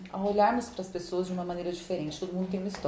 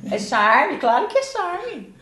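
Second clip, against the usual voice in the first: high, loud, playful teasing; teasing echo; 9 semitones higher, 8 dB louder, slower than usual. Acted. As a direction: tease him, high and drawn out. Teasing friend's lisp, echoing him.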